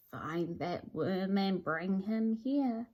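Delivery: low, soft, slow wizard mutter; lightly voiced sinister lizard muttering; sly wizard muttering an order to his crow. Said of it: "he mutters in his servant's ear".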